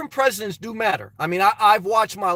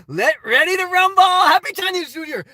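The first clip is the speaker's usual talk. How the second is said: higher, louder announcer-style shout; boxing-announcer hype, shouted; 7 semitones higher, 4 dB louder than usual. Acted. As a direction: call it out like a ring announcer.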